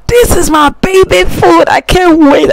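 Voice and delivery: put-on very high, squealing baby voice; excited baby voice. Mother speaking as her baby, very high, excited squeal. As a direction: squeal like a thrilled baby over food.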